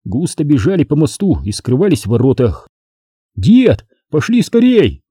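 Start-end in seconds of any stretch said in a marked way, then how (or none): 3.4-5.0 high, eager urging; urgent plea. A narrator's reading. Boy hurrying his grandfather into the battle, urgent. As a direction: urge them to hurry, pitched up.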